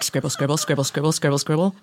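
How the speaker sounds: low, soft scribbling patter; note-taking mutter. Acted. Captain noting down crew gossip, low scribbling mutter. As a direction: murmur it under your breath like a busy pen.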